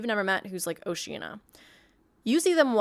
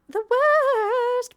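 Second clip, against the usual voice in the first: very high, loud, drawn-out wail; hammed-up exaggeration; 8 dB louder than usual. Warning about insufferable characters, hammed-up high wail.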